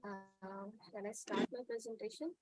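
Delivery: very faint, far from the mic; barely audible. Real, not acted. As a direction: voice too low to hear clearly. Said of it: "your voice is very low".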